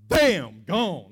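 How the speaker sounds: shouted bam, then gone; preacher punching bam to show how fast Satan fell; sudden loud bam. Acted. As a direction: slam the word bam out. Preacher acting out sudden fall.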